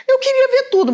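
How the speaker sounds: high, loud whiny mimicry of the passengers; mock whiny complainer. Mocking cruise guests who complain they missed things, high whine. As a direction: pitch it way up like a sulky guest. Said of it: "o pessoal vai falar".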